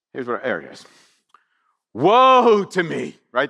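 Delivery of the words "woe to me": loud, long-drawn lament; acted prophet's wail; cry of agony. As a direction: draw out the woe as an anguished cry. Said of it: "that's a cry of agony".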